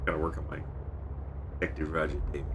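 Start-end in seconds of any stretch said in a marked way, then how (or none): 1.6-2.5 a lightly gruff, hard-bitten cop voice; Detective Roger Davis voice. Shown as a demonstration. Streamer testing a detective voice for a role, lightly gruff. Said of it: "That's his voice".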